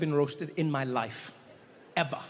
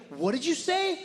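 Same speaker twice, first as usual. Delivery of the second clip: high, indignant mock outrage; offended; 9 semitones higher than usual. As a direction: take instant offence, voice jumping up. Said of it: "got so offended so quickly".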